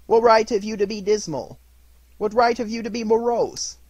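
high, loud, playful challenge; cheerful mock-indignant retort; gaily. A narrator's reading. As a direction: tease him back merrily. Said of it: "returned the nephew gaily".

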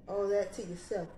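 ordinary talk, nothing marked

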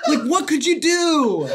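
high, slow, laughing disbelief; incredulous. Real, not acted. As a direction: ask in amused disbelief. Incredulous at odd law.

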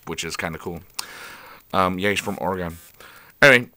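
usual speaking voice

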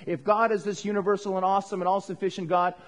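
normal talking voice

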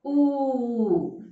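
ऊ held long and loud; drawn-out uu. Shown as a demonstration. Teacher introducing the next letter, ऊ stretched long. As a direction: pull the ऊ out. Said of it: "You should pull it and say".

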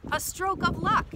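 high, delighted exclamation; delighted cry. A narrator's reading. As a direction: exclaim over a lucky find. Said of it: "cries Duck".

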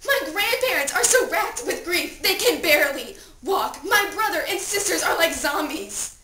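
loud, high, distraught cry; desperate plea. Acted. Dead teen at own funeral begging to wake, desperate. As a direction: beg frantically to be rescued.

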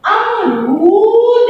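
अमरूद stretched slowly syllable by syllable. Shown as a demonstration. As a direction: spell out अमरूद slowly for children. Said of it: "ये क्या है".

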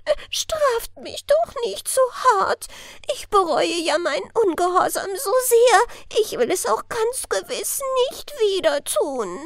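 very high, tearful cry for mercy; sobbing plea. A narrator's reading. Elf interrupting fir spirit, pleading high and sobbing. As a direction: beg through sobs. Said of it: "fiel das Elfchen ihm schluchzend ins Wort".

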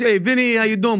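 a hint of a New York accent, hey Vinny how you doing; New York greeting. Shown as a demonstration. Host's guest shows the casual New York way to say his name, light New York accent. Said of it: "if you're in the New York mood".

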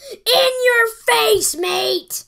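loud, high, slow gloat. Real, not acted. Gamer gloating at his opponent, loud and slow. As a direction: rub it in his face.

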